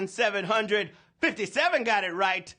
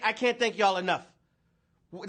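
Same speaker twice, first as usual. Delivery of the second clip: ordinary delivery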